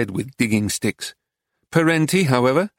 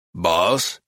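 put-on gravelly American accent; 6 semitones lower than usual. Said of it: "he repeated".

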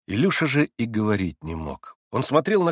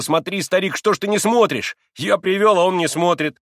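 high, loud, exasperated prodding; impatient urging; 7 semitones higher, 5 dB louder than usual. A narrator's reading. Boy urging his friend to watch the trick, impatient. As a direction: demand attention with eager impatience.

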